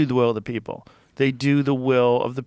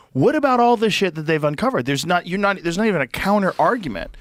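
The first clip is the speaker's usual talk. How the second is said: indignant challenge; 6 semitones higher, 4 dB louder than usual. Real, not acted. Indignant rhetorical challenge to opponents.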